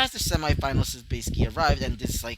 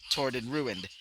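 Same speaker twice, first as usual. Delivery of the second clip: ordinary talk, nothing marked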